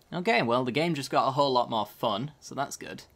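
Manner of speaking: ordinary talk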